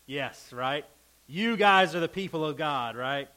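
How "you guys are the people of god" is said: higher, louder mock praise; mock his friends' wisdom; sarcastic paraphrase of Job's retort. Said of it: "he's being sarcastic about it".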